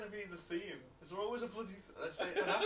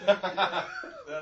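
loud words through real laughter; helplessly amused, much louder; laughing loudly; 16 dB louder than usual. Real, not acted. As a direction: belt it out while cracking up. Cracking up at the joke.

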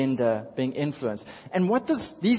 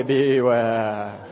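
louder ominous drawn-out "Beware"; spooky beware voice; 3 semitones lower, 6 dB louder than usual. Shown as a demonstration. Pastor leading the congregation in a beware voice, spooky warning. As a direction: warn the person behind you, spooky and loud. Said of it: "Can you do it in a beware voice?".

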